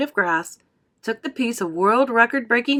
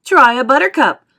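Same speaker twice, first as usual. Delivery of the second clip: loud, higher eager call; crying out; 5 semitones higher, 11 dB louder than usual. A narrator's reading. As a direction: urge them with gusto. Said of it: "cried Mister Wonka".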